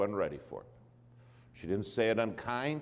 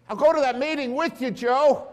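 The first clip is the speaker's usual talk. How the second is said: much higher and louder, bursting with eagerness; eager Jesus; 10 dB louder than usual. Retold or imitated. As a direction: call it out like an excited invitation. Jesus voiced in retold story.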